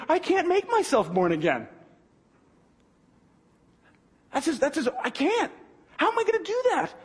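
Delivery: 模仿尼哥底母，越说越高的不解追问; incredulous Nicodemus. Retold or imitated. Nicodemus baffled, voiced by preacher.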